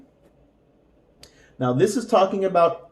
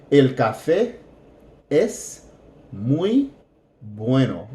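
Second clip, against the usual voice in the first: each word set apart with pauses; slow practice read. Shown as a demonstration. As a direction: say it word by word, slowly. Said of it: "let's practice this one".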